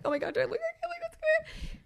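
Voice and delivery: squeaky high flustered squeal; mock panic. Checking herself in the mirror, high flustered squeal. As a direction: shoot way up in a frantic squeak.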